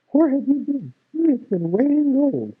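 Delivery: put-on high excited old woman; mother's voice. A narrator's reading. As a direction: chatter high like an amazed mother.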